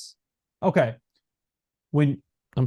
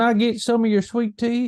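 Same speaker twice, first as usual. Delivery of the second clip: put-on high-pitched Southern belle accent; mock Southern accent; 10 semitones higher, 4 dB louder than usual. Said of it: "that's the typical, like, American accent".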